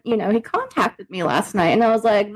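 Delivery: ordinary talk, nothing marked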